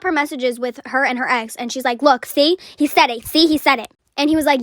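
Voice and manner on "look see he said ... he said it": triumphant accusing. Retold or imitated. Girl shows messages as proof.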